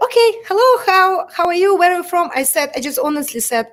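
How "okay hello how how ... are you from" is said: higher, louder, overly friendly greeting; imitating the casting person. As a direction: play the upbeat casting host asking questions. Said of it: "the person said".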